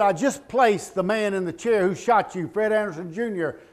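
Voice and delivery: high, loud storytelling from the pulpit; loud preaching narration. Acted. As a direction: drive the story home to the hall. Preacher telling story at full force.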